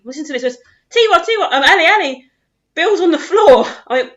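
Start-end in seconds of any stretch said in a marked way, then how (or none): 0.9-3.6 high, loud, panicked report. Retold or imitated. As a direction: blurt out the bad news. Keeper panicked announcing injury.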